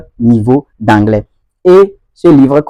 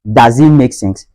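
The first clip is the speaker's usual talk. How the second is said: DOES as "dahz" in a Ghanaian accent; slow Ghanaian demo, open "ah" in does; slower than usual. Shown as a demonstration. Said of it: "Ça, c'est du côté ghanéen".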